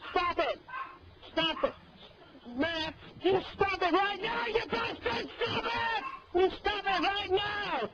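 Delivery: high, furious yelling at the troll; real angry outburst. Real, not acted. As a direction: yell it out, fed up and furious. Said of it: "You're really making me angry!".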